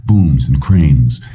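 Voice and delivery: plain reading voice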